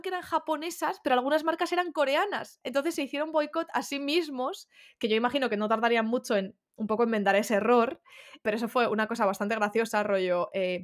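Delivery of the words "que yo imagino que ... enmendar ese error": ordinary delivery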